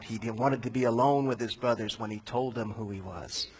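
usual speaking voice

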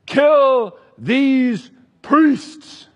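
very slow, loud, high command; furious order. Acted. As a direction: drag out the order like a raging tyrant. King orders the massacre.

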